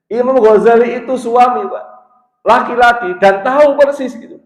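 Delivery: loud, high-pitched sermon voice; forceful preaching. Acted. Preacher arguing to the men that Ghazali knew marriage, forceful preaching. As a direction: hammer the point home high and loud.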